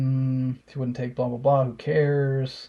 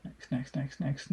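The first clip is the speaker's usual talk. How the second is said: muttered next; 9 dB quieter than usual. Real, not acted. Quickly skipping messages.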